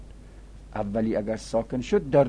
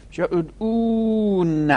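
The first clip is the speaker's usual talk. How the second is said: ادعون said higher and slow, stretched out; drawn-out emphasis; 9 semitones higher, 7 dB louder, slower than usual. Shown as a demonstration. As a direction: draw out ادعون as the answer. Teacher repeats the final form. (ادعون را بالا و کشیده بگو)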